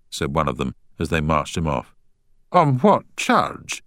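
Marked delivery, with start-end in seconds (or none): 2.5-3.8 sharp, drawn-out demand. A narrator's reading. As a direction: demand an answer. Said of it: "demanded Munro".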